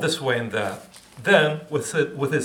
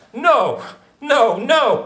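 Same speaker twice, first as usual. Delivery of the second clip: loud, high, half-laughing no; flustered author; 10 semitones higher, 7 dB louder, much slower than usual. Acted. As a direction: protest with a laugh. Kafka protesting.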